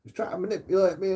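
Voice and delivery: high whiny mock complaint; mock girlfriend. Girlfriend complains to police about him, high whiny mock. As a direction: whine it like a wronged victim. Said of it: "where she hears it all".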